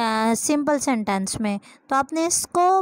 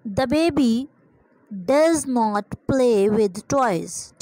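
slow dictation; much slower than usual. Shown as a demonstration. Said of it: "अब baby है तो does आएगा".

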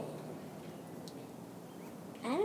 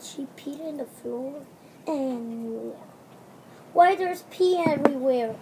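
ordinary delivery

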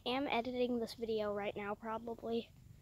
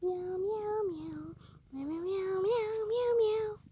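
cat meow; 7 semitones higher, slower than usual. Pretend. Meowing to call the cat.

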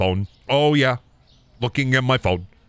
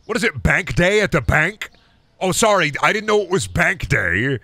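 mock apology, pitched up; sarcastic; 6 semitones higher than usual. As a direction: sneer it sarcastically. Mocking customer with hundred.

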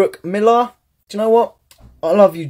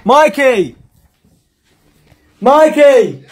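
calling out; 6 semitones higher, 5 dB louder, slower than usual. Real, not acted. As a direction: call loud across the house, higher. Calling brother to the door.

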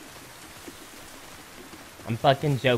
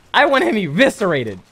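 louder, higher mock outrage; 8 semitones higher, 9 dB louder than usual. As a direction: play up the fake fury. Repeated louder after laughing.

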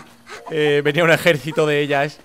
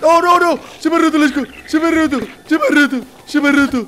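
high, loud panicked repeating; 11 semitones higher, 6 dB louder than usual. Real, not acted. Player whose shield has just broken, panicked. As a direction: yelp it over and over in alarm.

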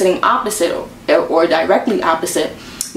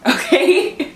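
laughing okay; 9 semitones higher than usual. Real, not acted. Laughs at her own point.

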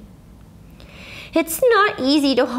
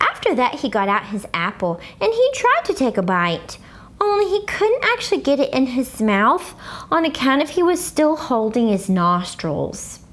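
ordinary delivery

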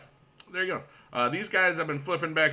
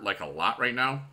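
normal talking voice

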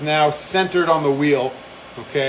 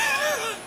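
high laughing fit, trailing off softer; helpless real laughter; 6 dB quieter than usual. Real, not acted. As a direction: lose it completely mid-sentence. Cannot finish reading the joke.

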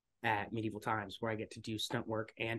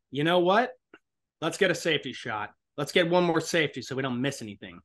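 raised, louder director calling a safety shot; director's call; 4 semitones higher, 7 dB louder than usual. Retold or imitated. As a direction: call out to the whole set like a director. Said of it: "the director said".

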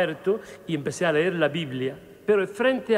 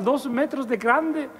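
ordinary delivery

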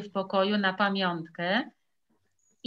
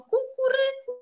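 very high crowing call; rooster crow. Acted. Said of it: "i zawołał".

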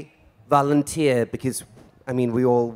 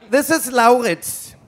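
grand introduction; 9 semitones higher, 6 dB louder than usual. Real, not acted. Presenting volunteer to crowd.

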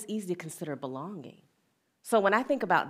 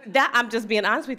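normal talking voice

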